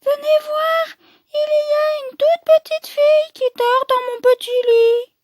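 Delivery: put-on very high, astonished Baby Bear; tiny exclaiming voice. Baby Bear discovering a girl in his bed, tiny high exclamation. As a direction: squeal it out in a teeny voice. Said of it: "il s'exclame de sa toute petite voix".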